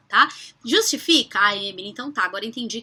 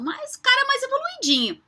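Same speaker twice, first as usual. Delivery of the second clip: playful tease; 12 semitones higher than usual. Real, not acted. Playful diminutive about Dalai Lama.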